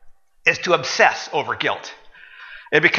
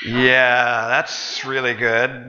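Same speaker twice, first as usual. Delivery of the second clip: slow, dry sarcastic praise; dry sarcasm; 4 semitones lower, much slower than usual. Real, not acted. Pastor mocking the excuse, slow and sarcastic. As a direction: drag it out, unimpressed.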